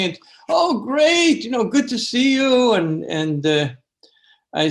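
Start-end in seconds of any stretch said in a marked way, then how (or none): 0.5-2.7 cheerful greeting. Retold or imitated. Teacher's cheerful greeting at door.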